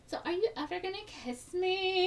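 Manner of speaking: put-on playful girl angling for a kiss. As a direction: bait him sweetly. Girl teases boy for a kiss.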